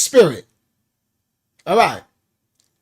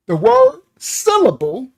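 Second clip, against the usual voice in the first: "word" lifted high and loud; teacher emphasis; 10 semitones higher, 5 dB louder than usual. Shown as a demonstration. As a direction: raise your voice sharply on "The word syllable". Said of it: "we're gonna use the word syllable".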